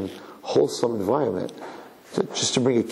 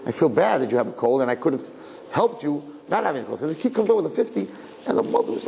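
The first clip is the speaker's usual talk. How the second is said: normal talking voice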